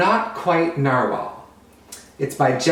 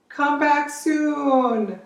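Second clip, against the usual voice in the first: much higher, very slow sing-song goodbye; fond farewell; 11 semitones higher, much slower than usual. Acted. Unicorns wave off their narwhal friend, slow high goodbye. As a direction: draw the goodbye out sweetly.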